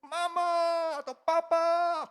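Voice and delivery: put-on drawn-out high child's cry for mama; child's scream. Toddler fighting over a toy, calling for parents in a high drawn-out scream. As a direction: wail like a small child calling for help. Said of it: "terdengarlah jeritan si anak kita ini".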